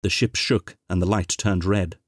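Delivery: plain reading voice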